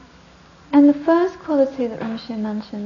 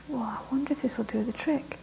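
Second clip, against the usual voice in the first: softer, sly whisper; quiet conspiratorial thought; whispered scheming; 7 dB quieter than usual. Acted. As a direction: hatch the plan under your breath. Son's sly inner thought.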